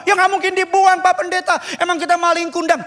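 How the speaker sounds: high, indignant-sounding assurance; protesting congregant. Retold or imitated. Engaged congregant assuring pastor parents won't be abandoned, protesting. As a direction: protest innocence, pitched well up.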